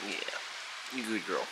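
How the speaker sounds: whispered, tender praise; soft praise. Real, not acted. Owner quietly praising her pet. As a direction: breathe it gently to her.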